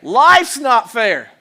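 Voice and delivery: loud, slow parental verdict; parent's retort. Acted. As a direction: lay it down slowly to a complaining child. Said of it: "What do we say to our children".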